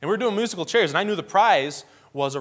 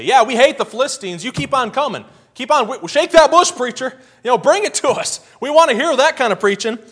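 high, loud whoops of approval; cheering crowd; 9 semitones higher, 9 dB louder than usual. Retold or imitated. Israelites urging the preacher on, high and loud. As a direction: hype him up, shake that bush.

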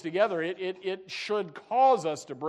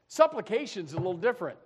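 ordinary talk, nothing marked

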